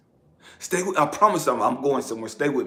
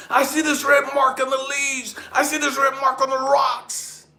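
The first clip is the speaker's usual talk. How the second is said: raised, insistent, chanting repetition; impassioned testimony; 6 semitones higher, 3 dB louder than usual. A narrator's reading. Man following red marks through the woods, impassioned. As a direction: build conviction with each repeated phrase.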